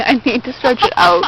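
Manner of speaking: usual speaking voice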